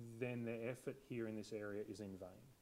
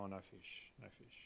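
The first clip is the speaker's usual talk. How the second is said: glum mumble; 5 semitones lower, 13 dB quieter than usual. Retold or imitated. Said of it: "They go".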